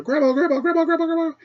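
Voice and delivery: put-on high, urgent child calling Grandma; panicked child pounding on a door, high and loud; frantic kid calling. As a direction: call out like a scared kid at the door. Kids banging door calling grandma.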